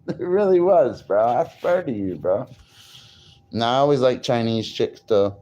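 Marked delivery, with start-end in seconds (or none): none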